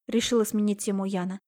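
ordinary delivery

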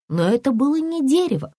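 ordinary delivery

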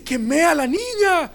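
high sobbing cry; wife sobbing the news on the phone. Retold or imitated. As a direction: cry it out in panic. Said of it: "solo estaba llorando".

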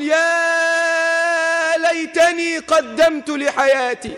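high, long-drawn wail of "ya"; acted cry of regret, slow and sustained; imitated regretful scream. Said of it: "عمال بيصرخ".

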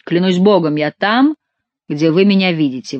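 plain reading voice

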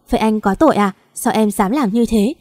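usual speaking voice

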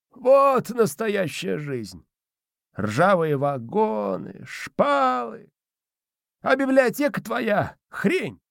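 put-on high, slow, scornful old spirit; squeaky old man, savouring it; domovoi voice. A narrator's reading.

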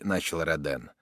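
ordinary delivery

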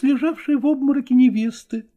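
usual speaking voice

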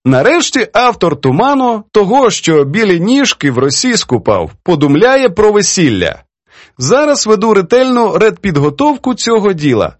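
high, showy reading of a note; reading aloud. A narrator's reading. Poetess reads editor's wedding invitation, reading aloud. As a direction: read it out in character, with relish. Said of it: "а потім уголос".

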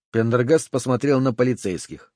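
ordinary delivery, nothing marked

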